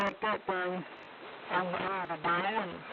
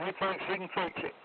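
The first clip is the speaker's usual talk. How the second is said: ordinary talk, nothing marked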